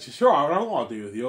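high, loud pretend acceptance; hammed-up burst of agreement; imitated eager yes. Woman agreeing to go on a date, imitated eager yes.

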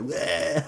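mock yell. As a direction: blurt out a loud silly wail. Acts out a crude shouted noise.

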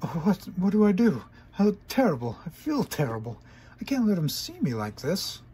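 high, loud flustered voice; frantic and flustered; high panicky mare. Acted. Trixie panicking at doorbell.

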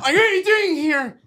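loud, high shouted question; angry shout. Retold or imitated. Son confronting mom in bedroom, shouted high. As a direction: shout it, outraged.

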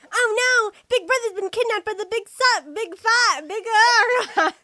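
loud, urgent cry; hammed-up panic over a kidnapping; acted alarm.

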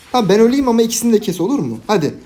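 high, loud, fast plea to the team; frantic gamer calling the play; urgent excited plea. Real, not acted. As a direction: rush the teammates into the trade. Urgent plea to teammates mid-fight.

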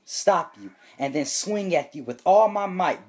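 ordinary talk, nothing marked

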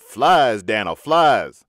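loud drawn-out call to the frog. Retold or imitated. Smiley calling his frog to catch flies, loudly sung out. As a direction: sing it out loud across the floor. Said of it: "sing out".

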